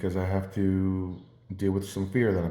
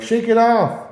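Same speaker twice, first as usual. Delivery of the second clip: high, loud mock shout; imitated yelling; 9 dB louder, slower than usual. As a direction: holler it like a coworker calling from afar. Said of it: "they actually yelled towards me".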